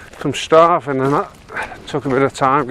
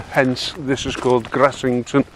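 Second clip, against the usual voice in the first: ordinary delivery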